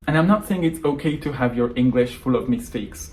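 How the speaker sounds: usual speaking voice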